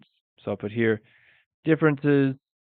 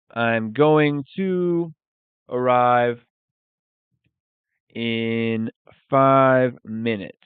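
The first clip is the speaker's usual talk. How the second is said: stretched out slowly, "arrive" said louder; slow teaching demo; 4 dB louder, much slower than usual. Shown as a demonstration. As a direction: say it slowly for the class to copy. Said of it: "you could say".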